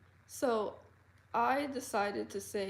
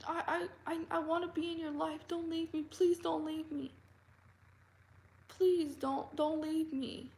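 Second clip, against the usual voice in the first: mock clingy begging; 7 semitones higher than usual. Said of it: "He's like".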